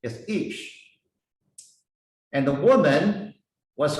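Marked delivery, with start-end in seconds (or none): 0.3-0.8 Ish said as eesh, softer; pronounced Ish. Shown as a demonstration. Teacher gives the Hebrew word for man, eesh. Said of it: "Hebrew word, is".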